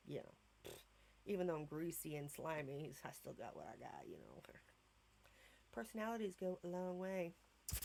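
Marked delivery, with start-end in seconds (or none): 3.0-4.4 low whispered aside; soft smug whisper; whispered brag. Real, not acted. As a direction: whisper it like a secret boast. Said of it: "self-assured aside".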